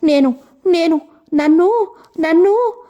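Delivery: stuttering, rising panic; breaking distress. A narrator's reading.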